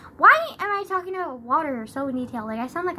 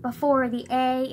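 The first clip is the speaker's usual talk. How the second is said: ordinary talk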